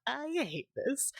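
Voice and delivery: mock-angry high, drawn-out whine; angry outburst. Streamer showing how she reacts to scares, mock anger. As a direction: play up a petulant little tantrum. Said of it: "then I get angry".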